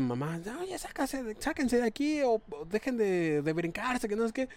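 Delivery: a hint of a mother shooing them off; scolding mother. (madre regaña a las morras del techo, voz algo aguda)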